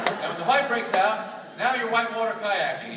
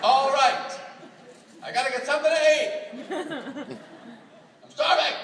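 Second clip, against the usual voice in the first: raised, higher hungry outburst; near yelling; 4 semitones higher than usual. Acted. Husband walks in hungry at home, near yelling. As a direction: announce it high and forceful, almost a yell. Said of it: "Why are you yelling at me".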